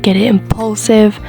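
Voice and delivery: usual speaking voice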